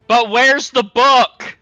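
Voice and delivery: anxious plea. Acted. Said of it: "he looks at the bag and goes".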